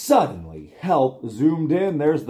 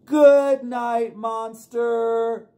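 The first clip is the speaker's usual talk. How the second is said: high, loud "Good night" to the monster; 9 semitones higher, 5 dB louder, much slower than usual. Shown as a demonstration. Leading kids in a loud good night to monster. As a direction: call it out big together. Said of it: "then we're gonna say, "Good night, monster".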